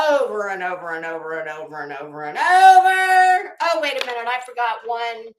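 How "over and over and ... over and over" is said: mock endless loop; droning repetition. Shown as a demonstration. Mocking someone's endless repetition.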